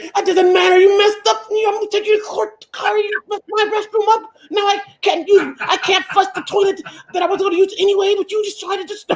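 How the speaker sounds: hammed-up woman threatening to take the contractor to court; mock furious woman. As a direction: yell at the contractor in a fake rage. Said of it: "I see you're wound up tonight".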